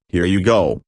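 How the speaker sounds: ordinary delivery, nothing marked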